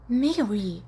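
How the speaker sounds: a mild scolding snap; angry hiss. Acted. Marlene scolding Mary in class, mild angry rebuke. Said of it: "Marlene sibilou com raiva".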